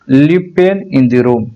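spoken very slowly, each word stretched out; slow translation. Shown as a demonstration. Teacher giving the English meaning slowly to learners. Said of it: "दाखिल गुरफा".

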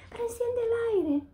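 high put-on voice for the lady's suggestion; quoting her boss. Worker quoting her employer's advice, pitched high.

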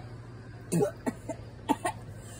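raspy coughing after spice; choking cough. Real, not acted. Coughing after the spicy bite.

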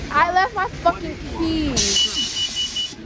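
real shock at forgetting the keys; sudden realization. Real, not acted. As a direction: caught off guard, dismayed. Realizes she forgot keys.

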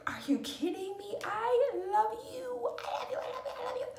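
high, breathy-free squeal of adoration; gushing fangirl. Retold or imitated. Meeting admired people at dinner, gushing. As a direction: gush like meeting your idols.

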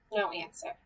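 usual speaking voice

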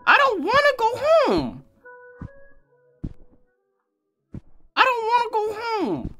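high, wailing whine; whining plea. Real, not acted. Gamer begging the game not to send him home, wailing.

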